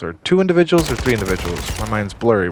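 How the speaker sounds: usual speaking voice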